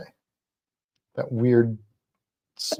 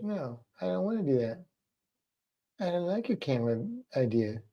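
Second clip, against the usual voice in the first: softer, quiet pet voice; voicing the lizard; 2 semitones higher, 11 dB quieter than usual. Owner voicing the lizard refusing the camera, quietly. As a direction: murmur the lizard's grumpy refusal. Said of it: "She's like".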